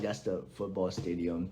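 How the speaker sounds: low, soft, matter-of-fact answer; deadpan local. Retold or imitated. Local answering a curious visitor, low and deadpan. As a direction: drop the voice low and quiet, unimpressed.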